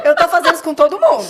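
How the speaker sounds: laughing loudly through the words. Real, not acted. Host laughs while explaining her joke. (falando rindo alto)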